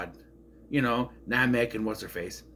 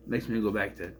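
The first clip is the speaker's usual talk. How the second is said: normal talking voice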